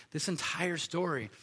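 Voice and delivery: normal talking voice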